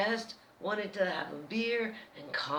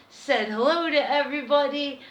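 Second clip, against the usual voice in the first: usual speaking voice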